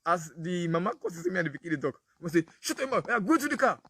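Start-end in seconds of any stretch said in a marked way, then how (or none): 2.6-3.8 high, sharp mother's order; mother snapping. Retold or imitated. Mother silencing her son before the doctor, high sharp order. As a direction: bark it at the child like an embarrassed mum. Mother scolding child quoted.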